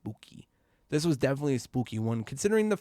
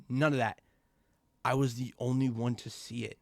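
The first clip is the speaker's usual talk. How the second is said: ordinary delivery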